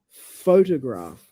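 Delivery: Photograph said slowly, PHO stressed; first-syllable stress. Shown as a demonstration. Repeating it for partner, Photograph stressed on PHO. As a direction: draw out PHO in Photograph, then fall. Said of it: "It's on the first syllable".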